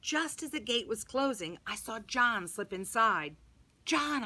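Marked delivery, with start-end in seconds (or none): 3.8-4.2 calling out. Acted. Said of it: "I called".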